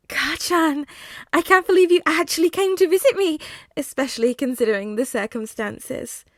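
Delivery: high, fast, gleeful villain; sing-song menace, too delighted; creepy villain voice. Acted. Villain Deku greets Katsuki.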